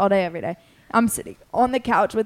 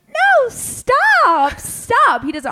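high, loud squealed no, stop; mock-squeal like a flustered friend; squealing denial; 13 semitones higher, 7 dB louder, much slower than usual. Friend squealing at being teased about a crush, flustered denial.

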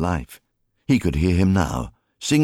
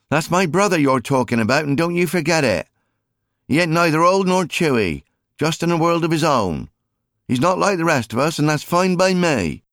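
high, louder calling wife; indignant sister; 11 semitones higher, 4 dB louder than usual. Acted. Mary calling after Jim to defend her brother, indignant.

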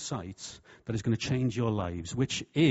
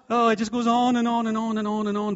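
much higher and louder, exasperated; loud exasperated rant; 9 semitones higher, 9 dB louder than usual. Real, not acted. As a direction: throw up your hands at the endless list. Exasperated listing of methods.

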